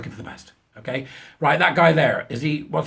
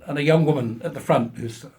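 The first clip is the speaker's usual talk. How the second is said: ordinary talk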